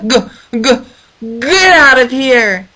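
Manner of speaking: stuttered then loud, slow yell; goat stammer. Acted. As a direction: bleat it like a goat. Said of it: "let out a".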